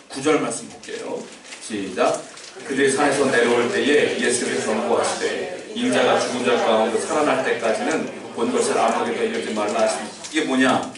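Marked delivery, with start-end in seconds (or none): 2.7-10.1 낮은 목소리로 또박또박 성경 봉독; scripture reading. Acted. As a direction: read the verses low and measured, like a lector. (성경을 낮고 차분하게 낭독)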